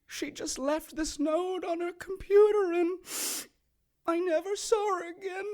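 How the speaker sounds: high, soft fake tearful pleading; mock grieving husband. Kay mimicking a killer lying to police, fake tearful whine. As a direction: play the innocent, fake the tears. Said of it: "and it's".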